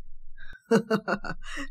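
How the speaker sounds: long soft acted cackle; breathless run of chuckles in character; helpless laughter. A narrator's reading. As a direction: laugh on and on at her shock. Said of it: "مات على نفسه من الضحك".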